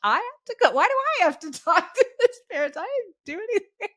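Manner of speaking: high, fast whiny protest; indignant kid. Retold or imitated. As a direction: protest like an outraged child. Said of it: "I was like".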